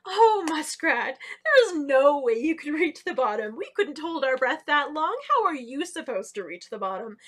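pooh-pooh the little muskrat; mocking laughter. A narrator's reading. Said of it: "started laughing at her".

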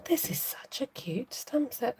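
slow, soft unvoiced whisper. Real, not acted. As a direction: breathe the words out slowly. Whispering over the crafting.

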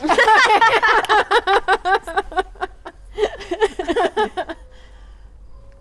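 high, loud helpless laughter; long laugh. Real, not acted. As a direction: burst out laughing.

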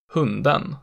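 put-on hunden in Old Stockholm dialect with accent two. Shown as a demonstration. Teacher showing century-old local speech, Old Stockholm accent two on hunden. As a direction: give hunden the Old Stockholm double-peak tone. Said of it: "generalized accent two onto everything else".

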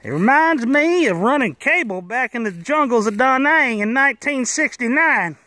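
high, loud call from up a pole; retold yell. Garrett calling down from the pole to the crew, high loud yell. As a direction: belt it out across the field like a coworker breaking the silence. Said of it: "Garrett yells out from the top of the pole".

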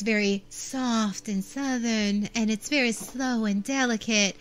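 slow, delicate Southern Belle drawl; Southern Belle accent. Shown as a demonstration. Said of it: "There's, like, the Southern Belle accent".

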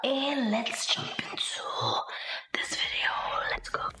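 unvoiced hushed whisper. Real, not acted. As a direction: keep it low so he can't hear. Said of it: "so I'm gonna talk real low".

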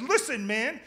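high, loud, exasperated retort; comic snaps back at his roommate, high and loud; snapping back. Retold or imitated. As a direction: finally lose patience and snap. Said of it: "that's when I snapped".

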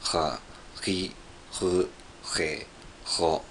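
"は、 ri, ru, he, ro" with a rough, fricative throat R; struggling French R, scraped in the throat on "は、 ri, ru, he, ro"; attempted French R. Shown as a demonstration. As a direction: practise "は、 ri, ru, he, ro" as separate French-R syllables. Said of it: "エーの発音はとても難しいです".

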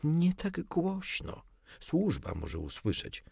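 hushed appeal, starting high and slow; pleading. A narrator's reading. Wife worries the servants may hear, pleading. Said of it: "zaczęła go błagać pani Darling".

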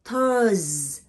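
"Thurs" loud and drawn out, buzzing on the final S; final S sound. Shown as a demonstration. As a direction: hold the end of "Thurs". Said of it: "you hear the S sound".

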